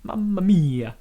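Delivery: hammy Italian "mamma mia"; bad Italian accent. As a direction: ham up a cartoon Italian. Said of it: "we're doing bad Italian accents".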